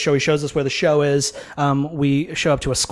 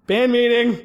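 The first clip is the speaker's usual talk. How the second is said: calling out; 8 semitones higher, slower than usual. Retold or imitated. Calling the band together.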